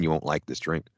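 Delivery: normal talking voice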